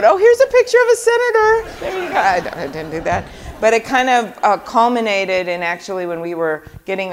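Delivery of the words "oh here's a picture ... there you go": high, bright mock tourist chirp; mock selfie voice. Senator imagining herself posing as a fan for a selfie, high mock chirp. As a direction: lilt it like a starstruck fan snapping a selfie. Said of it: "I always kinda wanna put it on the selfie mode".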